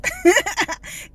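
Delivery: short laugh at her own accent; amused laugh. Real, not acted. Laughing at her own country accent.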